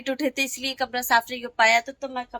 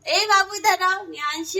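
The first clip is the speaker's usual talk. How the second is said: high, raised call to the little one; 6 semitones higher than usual. Real, not acted. Mother calling toddler over. (छोटे बच्चे को ऊँची आवाज़ में पुकारना)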